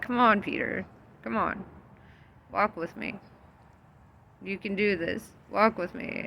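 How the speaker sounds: soft encouraging Jesus voice; gentle coaxing. Retold or imitated. Jesus gently calling Peter to walk to him, soft and coaxing. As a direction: coax him onto the water softly.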